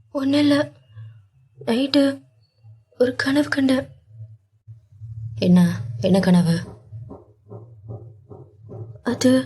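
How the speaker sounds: a hint of a quavering retelling of a nightmare; understated choked-up Meera. A narrator's reading. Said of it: "தழுதழுத்த குரலில்".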